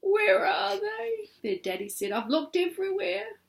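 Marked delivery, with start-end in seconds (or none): 0.0-1.3 high, tearful wailing mother; crying mother. Acted. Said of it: "their mummy was crying". 2.1-3.4 worried daddy. Acted. Daddy voiced in the story.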